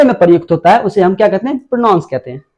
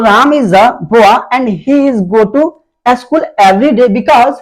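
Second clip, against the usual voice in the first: very slow, spaced words with pauses; slow dictation; much slower than usual. Shown as a demonstration. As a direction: dictate it so students can write along. Teacher dictates example sentence. (बहुत धीरे dictation की तरह)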